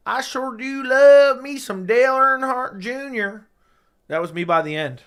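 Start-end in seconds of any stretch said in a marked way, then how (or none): 0.1-3.5 hammed-up Southern drawl, high and loud, slow; Southern accent; a NASCAR fan's Southern accent; Southern twang. Shown as a demonstration. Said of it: "talking like this".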